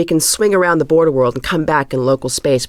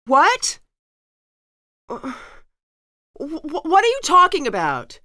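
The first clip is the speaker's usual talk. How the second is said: shocked disbelief; 10 semitones higher than usual. Acted. Accused of betrayal, caught off guard.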